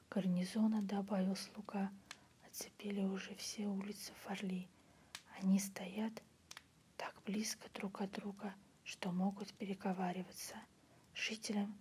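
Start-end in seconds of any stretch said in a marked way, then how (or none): none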